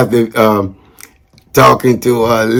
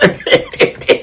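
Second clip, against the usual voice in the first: a sudden high airy burst of laughter; high breathy laugh; 3 dB quieter than usual. Real, not acted. Amused at the lime green car, high airy laugh.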